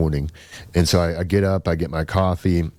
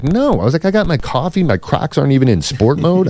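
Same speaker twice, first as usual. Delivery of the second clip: retold indignant refusal; 9 semitones higher, 6 dB louder than usual.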